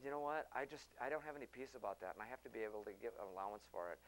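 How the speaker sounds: mild imitation of his younger self; quiet objection. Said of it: "I said".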